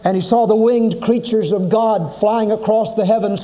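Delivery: ordinary talk, nothing marked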